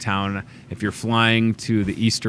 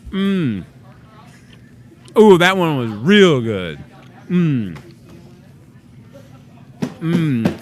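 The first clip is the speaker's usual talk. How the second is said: messy loud eating; 5 semitones higher, 4 dB louder, much slower than usual. Real, not acted. Eating cheese curds on air.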